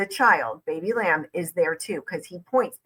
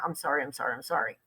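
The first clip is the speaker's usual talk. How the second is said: much softer, lower, cowering sorry; meek apology; 5 semitones lower, 10 dB quieter, much faster than usual. Shown as a demonstration. Commentator showing the guilty response, meek and soft. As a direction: shrink back and mumble the apology.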